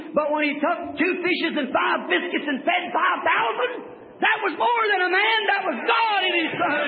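rising shouted sermon; full-force preaching. Real, not acted. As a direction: shout the miracle out to the congregation. Preaching climax on the feeding.

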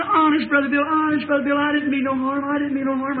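put-on high, insistent girl's voice; imitated tearful pleading. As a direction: plead innocence as a distraught girl. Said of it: "she's just crying like that".

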